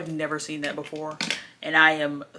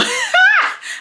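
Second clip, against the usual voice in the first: laughing; 9 dB louder than usual. Real, not acted. Laugh after whisper.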